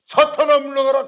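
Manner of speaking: high, very loud command; shouted rebuke. Acted. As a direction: cast out the devil at full shout. Acted sudden rebuke of Peter. (높고 크게 호통치는 목소리)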